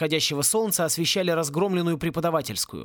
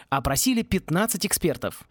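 usual speaking voice

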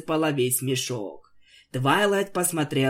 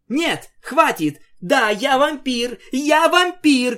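slow, high, loud desperate cry; shouting; 10 semitones higher, 6 dB louder, slower than usual. A narrator's reading. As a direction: scream the confession to make it stop. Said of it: "кричала Твайлайт".

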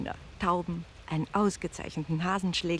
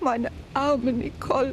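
lament; 4 semitones higher, 5 dB louder, slower than usual. Acted. Wailing landlady laments her maid.

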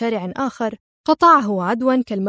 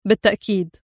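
mildly resentful confirmation; a touch of irritation; vexed reply. A narrator's reading. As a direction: concede with contained annoyance. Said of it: "أجابه المفتش في حنق".

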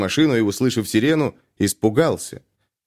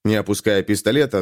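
ordinary delivery, nothing marked

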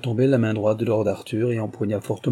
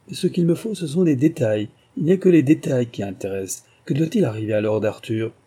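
eager society hostess; 3 semitones higher than usual. Acted. Hostess presses the palmist for details, eagerly. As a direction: push for the juicy details, pitched up.

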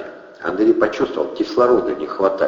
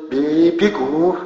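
high, slow, laboured stutter; strained stammer; 8 semitones higher, much slower than usual. A narrator's reading. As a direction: squeeze the word out with difficulty. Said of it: "с трудом выдавил армеец". (заикаясь, с трудом, высоко)

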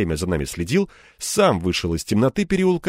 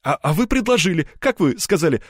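higher, eager scientist with a slight stammer; animated, raised-pitch recollection; livened up; 9 semitones higher than usual. A narrator's reading. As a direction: recall it excitedly, tripping at the start. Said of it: "тут же оживился учёный".

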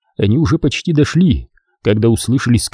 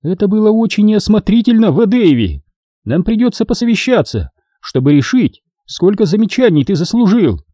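higher, sugary lecturing voice; a hint of a sanctimonious supervisor; preachy scolding; 9 semitones higher than usual. A narrator's reading. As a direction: lecture him with a forced smile. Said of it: "наставительную улыбку".

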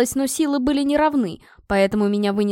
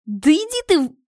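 higher, indignant yell cut short; raised-voice angry brush-off; yelled retort; 6 semitones higher, 4 dB louder than usual. A narrator's reading. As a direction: yell it back across a distance. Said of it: "проорала я в ответ".

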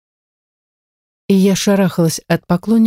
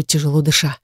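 ordinary delivery

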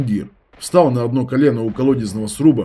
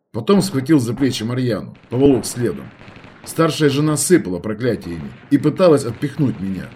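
ordinary delivery, nothing marked

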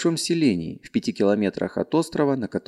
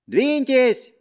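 shouting; 13 semitones higher than usual. Acted. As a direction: shout it over the crowd. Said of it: "прокричал он".

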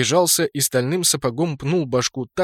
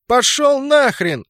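much higher, loud, slow yell; shouted insult; 12 semitones higher, 6 dB louder, slower than usual. Acted. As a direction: hurl it up at him. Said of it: "кто-то из толпы крикнул".

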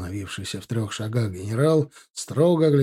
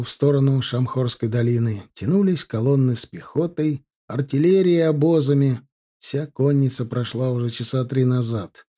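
plain reading voice

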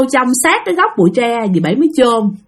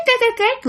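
high squeaky "két" noises; imitated teeth grinding; 12 semitones higher, 5 dB quieter than usual. Narrator shows child's teeth grinding, imitated squeak.

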